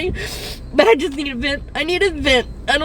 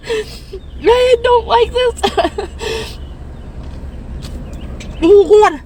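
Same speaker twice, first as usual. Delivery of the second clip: crying; 3 semitones higher, much slower than usual. Real, not acted. Breaking down in parking lot.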